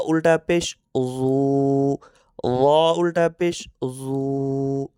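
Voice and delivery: very slow drill, জু রু গু held long; slow Quran-reading drill, vowels lengthened; slow drawn recitation. Shown as a demonstration. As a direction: stretch each final u like a chant. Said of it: "আমরা মস্ক করি".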